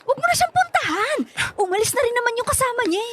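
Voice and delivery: high urgent call after a friend. Acted. Calling after friend, stopping him.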